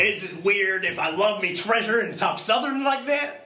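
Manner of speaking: put-on high pirate voice sliding into a Southern accent. Co-host defends his odd speech, high Southern-pirate accent. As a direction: mix pirate talk with a Southern twang. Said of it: "like a Southern guy from the States".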